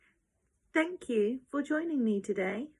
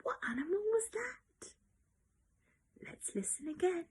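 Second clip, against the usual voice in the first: hushed, secretive whisper; soft unvoiced whisper of suspense; 3 semitones higher, 9 dB quieter than usual. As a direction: whisper it as if not to scare the animal. Whispering before playing a sound.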